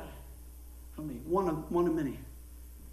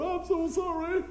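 mock crying; 8 semitones higher than usual.

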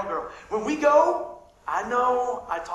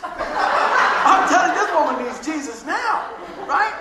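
louder and higher, slowly through laughter; laughing; 7 semitones higher, 7 dB louder, slower than usual. Real, not acted. Preacher laughing to the congregation about the thrill-seeking woman, laughing. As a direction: laugh it out while insisting.

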